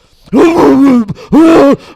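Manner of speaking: loud, high heaving noise; hammed-up cough-and-gag; imitated retching. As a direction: heave loudly as if about to vomit. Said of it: "and I'm going".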